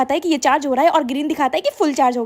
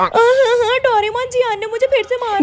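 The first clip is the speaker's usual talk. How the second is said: high whiny crybaby voice, much higher; whining kid; 7 semitones higher than usual. Acted. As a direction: wail to Doraemon for help. Whiny kid calling Doraemon.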